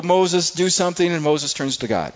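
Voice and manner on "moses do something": mock panicked plea. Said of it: "they're whimpering and crying".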